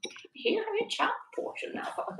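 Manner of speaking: an Irish accent attempted in a whisper; hushed Irish lilt, barely voiced; trying the Irish voice quietly and failing. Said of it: "I can't do it Irish accent quietly".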